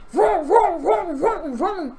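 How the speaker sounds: loud, high revving drill sound; imitated drill noise. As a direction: mimic a power drill in hard bursts. Imitates a drill at her window.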